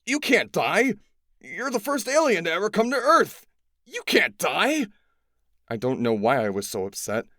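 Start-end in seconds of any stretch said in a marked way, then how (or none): 0.0-4.9 high, loud desperate pleading, slowing at the end. A narrator's reading. Boy begging the first alien on Earth not to die, loud and desperate. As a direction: beg him not to die.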